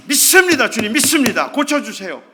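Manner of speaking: loud, firm declaration of faith; loud confident confession. Retold or imitated. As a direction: proclaim it boldly. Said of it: "이렇게 말해야죠".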